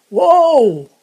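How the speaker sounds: loud, high shout to the horses; coachman's call. Acted. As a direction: bellow it like a coachman halting his team. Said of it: "said the coachman".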